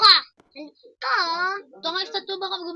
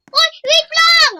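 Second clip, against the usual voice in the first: panicked shout; 9 semitones higher, 12 dB louder, slower than usual. Real, not acted. Being left behind.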